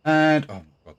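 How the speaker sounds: high, loud oh my God; surprised. Real, not acted. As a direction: caught off guard, amazed. Reacts to huge mana.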